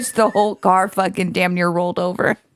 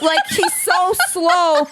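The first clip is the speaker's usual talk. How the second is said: laughing slowly; 7 semitones higher, slower than usual. Real, not acted. Laughing about clueless friend.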